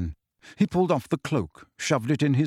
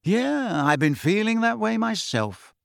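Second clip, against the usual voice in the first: breezy, carefree reply; light and airy; 8 semitones higher than usual. A narrator's reading. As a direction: toss it off lightly. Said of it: "said George lightly".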